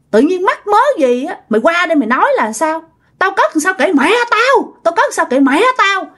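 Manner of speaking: high-pitched, scolding neighbour, acted out; angry cursing. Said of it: "bà Sáu bà mới chửi".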